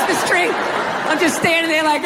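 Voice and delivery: usual speaking voice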